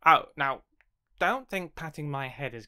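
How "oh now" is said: plain reading voice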